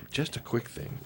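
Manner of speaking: whispered aside. Shown as a demonstration.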